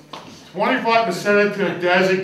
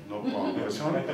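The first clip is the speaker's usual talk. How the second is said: gentle Jesus; 10 semitones lower, 9 dB quieter than usual. Acted.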